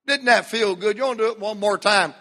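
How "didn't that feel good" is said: ordinary talk, nothing marked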